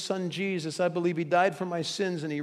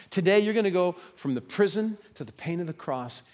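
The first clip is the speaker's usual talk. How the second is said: normal talking voice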